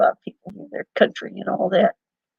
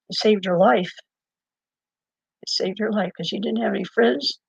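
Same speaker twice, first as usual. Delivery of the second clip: ordinary talk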